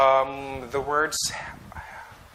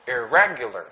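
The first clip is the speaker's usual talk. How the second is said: "Irregular" with a strong RE; stress on second syllable; 3 dB louder than usual. Shown as a demonstration. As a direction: punch RE in "Irregular", let the rest drop. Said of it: "The stress is in the re".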